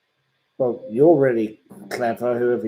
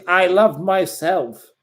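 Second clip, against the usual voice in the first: higher, slower preening voice; mock self-love; 8 semitones higher, 7 dB louder, slower than usual. Mocking the troll as smug and vain, drawn out. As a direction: play the vain troll adoring himself. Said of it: "Chegwin says".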